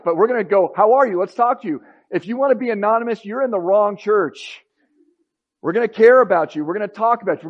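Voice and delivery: high, loud and driving, all in; rally them like a coach before a game; fired up. Retold or imitated. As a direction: pastor reliving his fired-up answer to a new leader. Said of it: "And I just got fired up".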